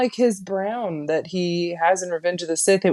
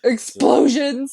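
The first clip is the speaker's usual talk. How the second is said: excited; 5 semitones higher, 6 dB louder, slower than usual. Real, not acted. Excited reaction to the scene.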